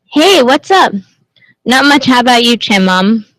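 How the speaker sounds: normal talking voice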